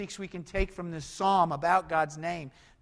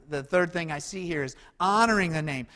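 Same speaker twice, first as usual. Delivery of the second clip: ordinary delivery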